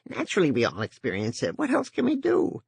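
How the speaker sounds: squeaky high voice. A narrator's reading. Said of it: "in a squeaky, high-pitched voice".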